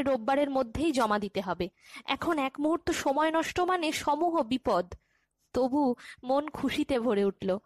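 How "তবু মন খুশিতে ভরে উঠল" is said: plain reading voice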